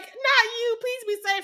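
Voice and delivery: high-pitched hammed-up plea; mock pleading. Fan re-enacts watching favourite in danger, high mock pleading. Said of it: "And I was like".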